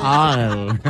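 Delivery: Oil said low with the tongue stuck to the palate; Oil, tongue glued to the roof of the mouth; peanut butter mouth. Shown as a demonstration. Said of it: "Es como la lengua pegada al paladar".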